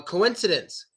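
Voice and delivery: ordinary talk, nothing marked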